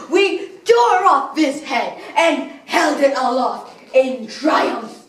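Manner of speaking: high, fierce theatrical exultation; savage triumph. Acted. Villain recounts tearing off the mascot's head, triumphant. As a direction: relive the victory in a rising cry.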